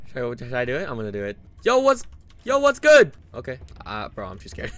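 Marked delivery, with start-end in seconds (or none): 1.6-3.1 high, loud greeting as a challenge; challenging shout. Real, not acted. Player confronts the intruder, challenging shout. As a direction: holler it at an intruder.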